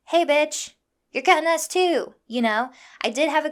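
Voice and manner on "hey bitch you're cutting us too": raised, higher voice confronting someone; imagined call-out. Retold or imitated. Imagined bystander challenging a line-cutter, loud indignant call-out. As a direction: call her out sharply, louder and higher.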